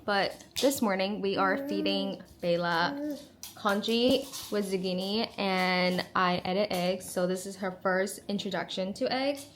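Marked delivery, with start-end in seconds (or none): none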